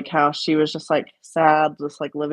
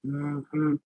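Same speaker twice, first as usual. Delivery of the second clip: imitating an uncle's movie-quote voice; Uncle Jerry; 6 semitones higher, 3 dB quieter than usual. Niece shows cohost uncle's bit, imitated movie-quote voice. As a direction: quote it like the uncle, hum trailing off softer. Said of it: "He'd be like".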